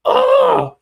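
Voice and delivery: high, loud enraged shriek; imitated outraged yelling, ending in a scream; mock furious. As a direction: scream it like a fighter losing it. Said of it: "just all fucking mad".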